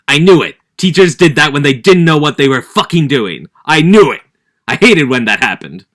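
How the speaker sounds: loud, heated rant; annoyed outburst. Real, not acted. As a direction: vent about teachers loudly. Speaker angrily recalls teachers.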